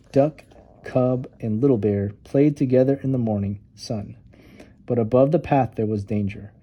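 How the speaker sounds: ordinary delivery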